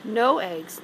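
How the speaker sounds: ordinary delivery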